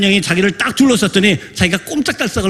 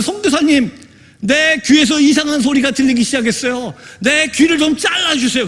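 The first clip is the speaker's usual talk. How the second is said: higher-pitched, mildly raised pleading voice; retold cry for help; 6 semitones higher than usual. Young man begs missionary to cut off his ears, retold outcry. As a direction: imitate a desperate plea without really shouting. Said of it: "소리 질러요. 그러면서".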